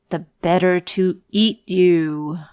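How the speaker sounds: loud menacing wolf voice. Acted. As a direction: growl it out as the wolf.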